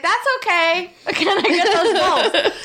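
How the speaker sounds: high, slow laughing request; laughing cheekily. Retold or imitated. Asking the annoyed worker for free bulbs, high laughing plea. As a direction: giggle while asking for freebies.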